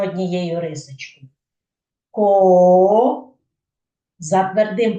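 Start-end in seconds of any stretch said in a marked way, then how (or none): 2.1-3.3 КО loud and long; isolated syllable ко, drawn out for the class; sounding out. Shown as a demonstration. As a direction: stretch out ко, holding the vowel as it rises. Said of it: "Яким першим ви чуєте звук".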